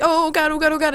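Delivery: high repeated fretting; mock panic. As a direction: imitate freaking out, high-pitched. Retells freaking out over friends.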